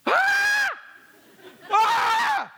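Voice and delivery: high shrieking cry of fright; sudden high yelp of fear; mock terrified scream. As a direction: scream like you've seen a ghost. Disciples scream seeing ghost.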